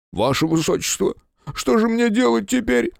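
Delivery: high, whiny plea; whimpering. A narrator's reading. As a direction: whimper out the question. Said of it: "прохныкал фотограф".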